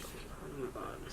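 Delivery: hushed, voiceless muttering to yourself; acted private whisper of dread; whispered dismay. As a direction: whisper it under your breath in dismay. Reaction to being told off.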